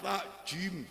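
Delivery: ordinary delivery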